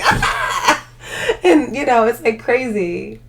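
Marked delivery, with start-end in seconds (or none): 0.0-0.8 high, airy laughing shriek; mock scream back. Retelling yelling back at the rat, airy laughing shriek. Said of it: "And you're like".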